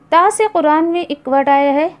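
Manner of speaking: ordinary delivery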